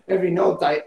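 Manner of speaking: usual speaking voice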